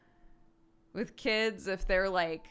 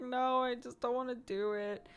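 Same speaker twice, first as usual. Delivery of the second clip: put-on reluctant little kid; 5 semitones higher than usual. Imitates reluctant child.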